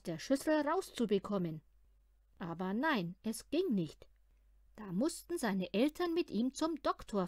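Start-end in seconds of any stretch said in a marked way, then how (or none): none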